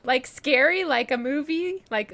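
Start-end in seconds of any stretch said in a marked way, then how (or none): none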